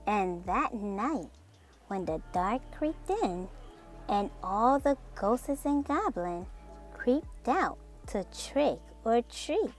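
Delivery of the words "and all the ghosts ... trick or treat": ordinary delivery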